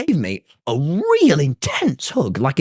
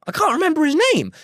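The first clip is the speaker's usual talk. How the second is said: higher still and faster, pressing the point; insistent repeat; 12 semitones higher, faster than usual. Retold or imitated. Retold nuisance fan insisting he can't recall, high and fast. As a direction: hurry the repeat up high like a nagging stranger.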